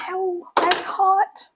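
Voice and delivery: slow, high pained ow at the heat; startled ouch, drawn out slowly; real burn. Real, not acted. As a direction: yelp as the hot rice hits. Burns herself on the rice.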